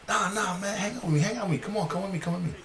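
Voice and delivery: Butch coaxing. Retold or imitated. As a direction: talk him into hanging out. Butch urging him along.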